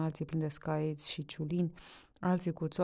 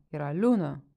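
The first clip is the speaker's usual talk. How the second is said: insistent shout; 7 dB louder than usual. A narrator's reading. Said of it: "strigă Trifan".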